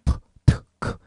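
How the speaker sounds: 푸, 트, 크 as bare puffs of air; voiceless consonants. Shown as a demonstration. Teacher demonstrating sounds without vocal-cord vibration, 푸, 트, 크 voiceless. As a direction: release 푸, 트, 크 with no vibration in the throat. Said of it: "성대 진동이 생기지 않거든요".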